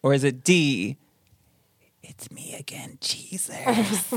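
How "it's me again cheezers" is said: very soft ghost imitation; hushed, spooky EVP voice; ghostly whisper.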